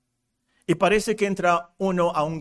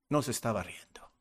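whisper; 7 semitones lower, 9 dB quieter, faster than usual. Real, not acted. Solemn point at funerals.

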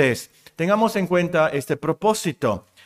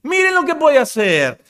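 much higher, louder, very slow; astonished exclamation; 12 semitones higher, 5 dB louder, slower than usual. Retold or imitated. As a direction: marvel loudly at the man.

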